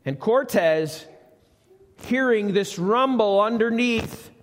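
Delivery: ordinary talk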